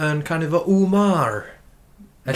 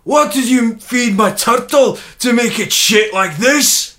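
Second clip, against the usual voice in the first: loud, high, very slow Scottish accent; hammed-up Scottish exclamation; 7 semitones higher, 5 dB louder, much slower than usual. Shown as a demonstration. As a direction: stretch it out as a broad Scot.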